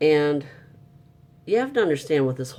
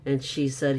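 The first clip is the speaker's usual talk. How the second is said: ordinary talk, nothing marked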